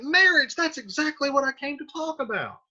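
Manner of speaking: acted mother pouncing on the topic, pitched high; eager mock exclamation. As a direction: burst in high on the first word, then keep it up. Mother seizes on the topic of marriage.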